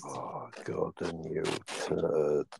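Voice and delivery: whispering. Real, not acted. Annoyed at absent friend.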